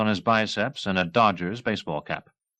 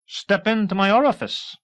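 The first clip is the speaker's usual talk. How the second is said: much higher, pompous; puffed-up invitation; officer showing off proudly; 12 semitones higher, 4 dB louder than usual. A narrator's reading. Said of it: "Murdoch's chest inflated".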